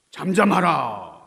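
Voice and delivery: 크고 길게 끄는 호령; 바다를 꾸짖어 잠재우듯 크게 명하기; 단호한 명령. Acted. 예수가 바다에 명령. (우렁차고 느린 명령조)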